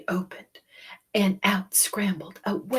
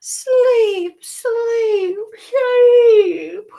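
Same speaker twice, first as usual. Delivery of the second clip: high, loud sleepy babies; drowsy little voices; tired babies; 13 dB louder, much slower than usual. Acted. The tired babies asking for sleep. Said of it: "the tired babies finally whispered".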